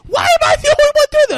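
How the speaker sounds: very high, fast, loud mock screech; frantic shrieked protest, played for laughs; acted exasperated shouting. Retold quarrel with Susan.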